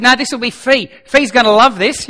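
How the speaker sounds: high, loud eager excuse; mock excited mate. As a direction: play the mate's eager cover story. Mate's excuse, retold.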